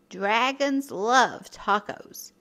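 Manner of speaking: ordinary delivery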